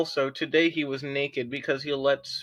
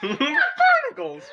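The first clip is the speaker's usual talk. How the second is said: high squeal through laughter; laughing mock yell; 4 dB louder, much slower than usual. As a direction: mimic the yell while cracking up. Mocking the yelled line, laughing.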